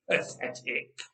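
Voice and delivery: contemptuous unvoiced mutter under the breath. Giant mutters in contempt at the cowering army. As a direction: sneer it almost without voice. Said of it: "Goliath muttered".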